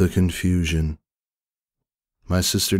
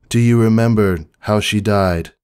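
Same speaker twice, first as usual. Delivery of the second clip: raised, firm voice; loud; 4 semitones higher, 6 dB louder than usual. A narrator's reading. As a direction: press the question loudly. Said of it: "I say this in a loud voice".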